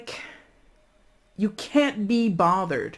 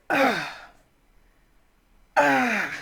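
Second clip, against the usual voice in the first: frustrated groan; 9 semitones higher than usual. Pretend. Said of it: "And you're just like".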